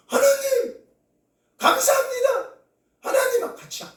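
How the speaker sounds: very high, straining thanks to God; dying girl's final thanks, high and straining; last-breath outcry. Retold or imitated. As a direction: push the last air out in a high cry.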